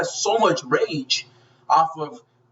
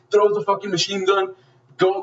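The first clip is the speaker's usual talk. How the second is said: ordinary talk, nothing marked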